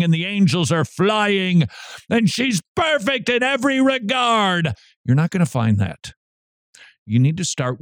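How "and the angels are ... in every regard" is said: high, swelling fairy-tale rapture; hammed-up starry-eyed fantasy; mock gushing. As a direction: gush higher and higher in mock bliss. Mocking a fairy-tale romance fantasy.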